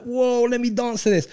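high excited whoa; mock excited. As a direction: pretend clubgoer hyped up. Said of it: "you're not like".

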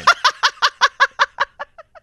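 high laughing burst; high burst of laughter. Real, not acted. Laughs at own spelling joke.

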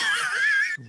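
a high whooping shriek of a laugh. Real, not acted. Host shrieks with laughter at the right answer. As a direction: burst out in a squealing laugh.

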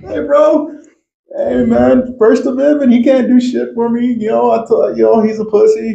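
high, cocky mock bravado. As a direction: puff up like a tough guy. Retelling own cocky words.

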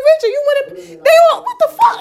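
high-pitched, exasperated retold outburst. Telling Mom how she confronted Richard over passengers, exasperated. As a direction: relive the disbelief, voice shooting way up.

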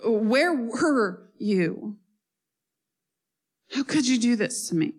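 mild anguished plea to God. Acted. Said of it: "voicing Job crying to God".

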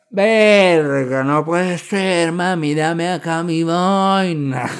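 loud slow drawn-out exasperation; exasperated outburst. Retold or imitated. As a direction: drag it out, fed up and loud. (arrebato exasperado, fuerte y lento)

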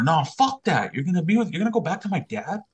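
high-pitched indignant disbelief; retold outburst, voice shooting up; outraged protest. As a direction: act out a teenager objecting to his mom. Teen outraged at mother's decision.

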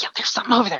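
whisper. Retold or imitated. Jamie insisting in hiding.

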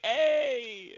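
high, loud drawn-out party hey; imitated whooping hello from the party; excited greeting. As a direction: burst in with a long, loud greeting. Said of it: "everyone's like".

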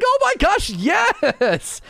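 overwhelmed outburst. Retold or imitated. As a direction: squeal it out like you're sobbing at the TV. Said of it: "And I was like".

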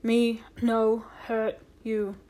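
slow, word-by-word pidgin; slow, deliberate reassurance in broken English; imitated pidgin English. A narrator's reading. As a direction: speak haltingly, as if to a foreigner. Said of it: "in what he supposed was Indian English".

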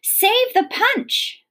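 high, drawn-out storybook yell; narrated yell. A narrator's reading. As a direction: call it out high and stretched. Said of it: "Gloria Dump yelled to me".

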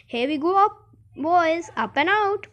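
high, loud excited send-off; rally the passengers as you launch; rallying call. A narrator's reading. Wonka launching the lift, calling out.

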